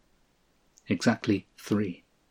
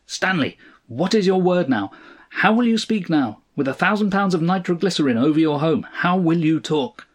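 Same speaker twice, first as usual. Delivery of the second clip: high, forceful calling from the sky; iron-fisted demand; 7 semitones higher, 3 dB louder than usual. A narrator's reading. Sky voice threatening Stanley over his home, forceful. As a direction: challenge him, pitched well up. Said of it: "fell on him like an iron fist".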